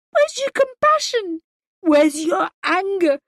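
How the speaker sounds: high, loud, and relentless; furious tirade. A narrator's reading. Wife rages at husband over missing daughter, furious. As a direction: demand he feel something.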